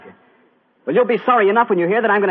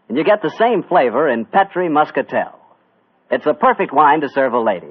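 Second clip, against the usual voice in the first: radio ad read; 8 semitones lower than usual. Acted. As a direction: sell the wine like an old radio announcer. Sponsor ad read.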